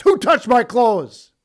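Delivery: loud, slow, searching question; Jesus calling out. Acted. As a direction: boom it out over the crowd. Jesus voiced again, loud.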